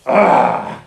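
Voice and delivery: loud breathy bark like a showing-off dog; sudden voiceless dog huff; mock dog bark.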